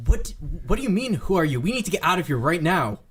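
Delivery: loud, high, incredulous urging; exasperated disbelief. Acted. Master of guards answers baffling question, incredulous. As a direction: sputter at them, then hurry them out.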